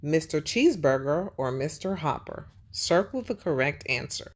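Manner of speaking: usual speaking voice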